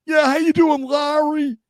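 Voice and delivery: high, squeaky exec voice, drawn out; high cartoonish impression of a GM; mock Trent Baalke. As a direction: ham up a squeaky general manager. Baalke voice dialogue.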